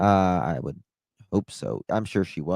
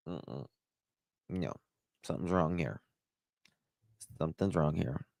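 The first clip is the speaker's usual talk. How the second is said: very soft, lower retold quote; quiet misgiving; 3 semitones lower, 11 dB quieter than usual. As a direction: murmur it low, imitating her doubt. Retold doubting woman's quote.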